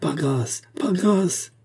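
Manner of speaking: high cry rising on the repeat; pleading cry. A narrator's reading. Musketeer begging the fleeing rider, pleading cry. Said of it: "s'écria D'Artagnan".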